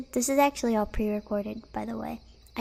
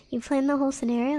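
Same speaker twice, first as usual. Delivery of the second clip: ordinary talk, nothing marked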